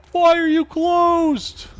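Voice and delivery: very high, loud, stretched-out mock cry; mock wail. Player complaining to locked doors after hours, high drawn-out mock wail. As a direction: cry it out high and slow, hamming it up.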